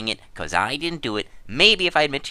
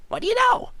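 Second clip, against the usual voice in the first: sarcastic; 5 dB louder, faster than usual. Real, not acted. Sarcastic quarrel over stolen eggs.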